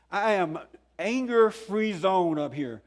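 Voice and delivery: ordinary talk, nothing marked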